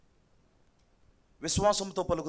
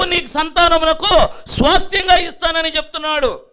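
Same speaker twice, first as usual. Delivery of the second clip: loud, higher-pitched pulpit voice; raised, ringing sermon delivery; full-force preaching; 9 semitones higher, 14 dB louder than usual. Real, not acted. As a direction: proclaim it to the whole congregation.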